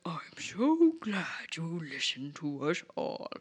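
slow, whispered creepy mentor; slow sinister whisper. Retold or imitated. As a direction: hiss out satisfaction slowly. Sinister mentor welcomes pupil.

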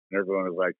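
usual speaking voice

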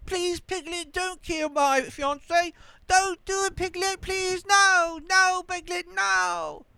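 very high, hammed-up pleading whine; mocking whiny plea. Mocking the actor begging Piglet not to kill her, high whiny plea. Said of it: "the way this guy is acting is just".

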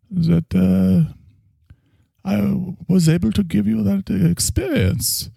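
German therapist voice; German accent. Acted.

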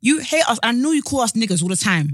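aggrieved outburst at someone absent; indignant accusation. Acted. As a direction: hurl the accusation at her. Heated mock address to absent actress.

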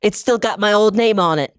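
ordinary talk

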